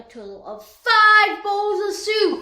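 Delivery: loud triumphant shout; proud boast. Acted. Child boasting about five bowls, loud proud shout. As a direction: brag it to the whole room.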